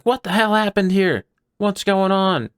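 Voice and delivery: loud, high-pitched guard demanding answers; alarmed guards. Acted. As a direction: shout it as a guard arriving on the scene. GM voices guards arriving at scene.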